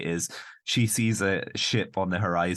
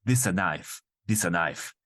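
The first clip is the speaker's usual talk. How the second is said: put-on Italian accent. Showing off a knife, hammed-up Italian accent. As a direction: present it grandly in a broad Italian accent.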